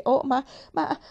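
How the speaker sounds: high, loud, broken stammer; the maid stammers in shock at the mix-up; stammering in alarm. Acted. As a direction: blurt it out in sudden panic. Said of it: "balbettò".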